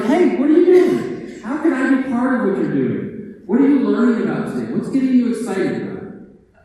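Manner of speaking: genuinely curious and upbeat; eager friendly librarian. Retold or imitated. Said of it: "As opposed to something like".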